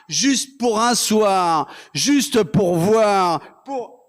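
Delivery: slow, stretched phrase endings; drawn-out declamation. Acted. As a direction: draw out each phrase like a slam poet. Slam poem, stretched list phrases.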